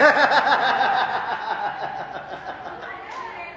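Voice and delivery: long laugh, falling away; real laugh. Real, not acted. Preacher laughing at the exchange, trailing off. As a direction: let the laugh run out.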